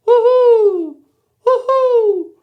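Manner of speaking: very high, loud owl hooting; bright owl call repeated. Shown as a demonstration. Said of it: "an owl hooting sound".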